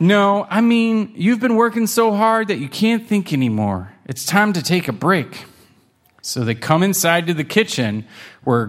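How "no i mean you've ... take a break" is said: high, kindly grandma voice. Acted. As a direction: ease them gently like grandma. Grandma consoles kittens.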